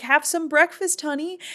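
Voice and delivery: high-pitched wifely invitation; mock sweet coaxing. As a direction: pitch it up, playing the coaxing queen. Retold queen coaxing the king.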